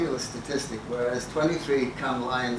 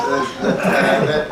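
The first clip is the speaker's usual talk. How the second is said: laughing; 7 dB louder than usual. Real, not acted. Laughing through remark.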